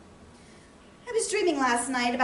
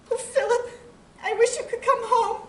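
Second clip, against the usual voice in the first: higher, plaintive cry to an absent husband; anguished longing; 9 semitones higher than usual. Acted. Lonely wife to her soldier husband, anguished longing. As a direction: ache for him to come home, pitch lifted.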